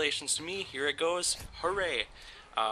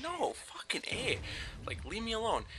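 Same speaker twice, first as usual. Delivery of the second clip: high, strained retold brush-off, softer; mock shrill brush-off; 9 semitones higher, 8 dB quieter than usual. Telling his ex-girlfriend to leave him alone, in a high mock whine. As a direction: mimic himself shooing off his ex in a high strained voice. Retelling rejecting his clingy ex.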